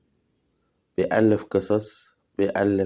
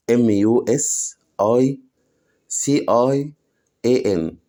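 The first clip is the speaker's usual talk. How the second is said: slow spelling; much slower than usual. Shown as a demonstration. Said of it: "بص حروفها عاملة إزاي".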